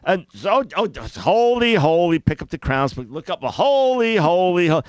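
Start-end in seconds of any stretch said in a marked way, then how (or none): 0.1-2.2 weary mock worshipper. 3.4-4.8 weary mock worshipper. Parodying dutiful worship.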